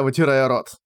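usual speaking voice

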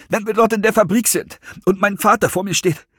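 high, anxious blurting; agitated and high-pitched; breathless outburst. A narrator's reading. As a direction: push it out as if out of breath. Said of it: "stieß er atemlos hervor".

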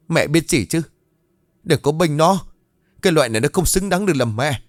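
high, heated rebuke; furious son arguing back at his mother; angry retort. A narrator's reading. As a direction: shut down anyone defending her. Son angrily rejects his mother's defence.

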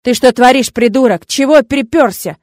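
loud, outraged scolding; furious, hard-hitting questions; angry yell. A narrator's reading. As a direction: tell an intruder off at full force. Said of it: "заорал уже Ворт".

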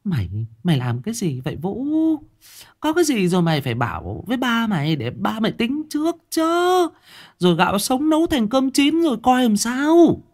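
mother glaring at her son and tearing into him; scolding mother. Acted. Mother scolding her son about the pregnancy, shrill and angry. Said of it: "Bà Loan bấy giờ trợn mắt nhìn con".

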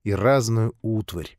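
ordinary delivery

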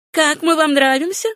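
high, flirtatious question; playful, high-pitched flirting; coquettish. A narrator's reading. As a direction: ask it coquettishly, lilting upward. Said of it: "кокетливо спросила Этта".

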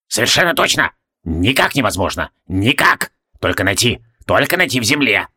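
lightly voiced old man, categorical; eccentric old alchemist, adamant. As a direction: rule it out in short exclamations. Sebastius insists it is impossible.

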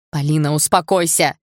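loud, urgent plea to calm down; shouted. A narrator's reading. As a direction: shout to get through to her. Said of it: "закричал Игорь".

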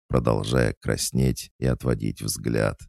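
plain reading voice

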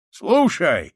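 loud, high soldier's cry; shouting. A narrator's reading. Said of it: "закричал солдат".

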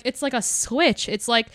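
ordinary talk

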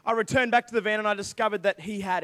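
ordinary delivery

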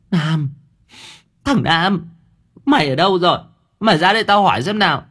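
high, insistent calling-out; landlady summoning. A narrator's reading. Shaken landlady calls Nam over.

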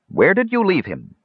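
high, pressing question; persistent. A narrator's reading. Canfo questions the defender, persistent. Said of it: "persisted Canfo".

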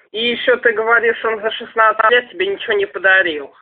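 ordinary talk, nothing marked

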